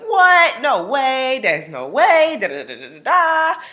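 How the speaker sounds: high loud stunned husband; shocked disbelief. Retold or imitated. Husband hears she is pregnant, loud and stunned. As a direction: blurt out total shock.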